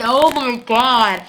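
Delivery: loud, drawn-out fangirl outburst; excited exclamation. Real, not acted. Vlogger gushing about Tyler and his date, excited. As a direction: belt it slowly, stretching each word. Gushing as she reaches favourite couple.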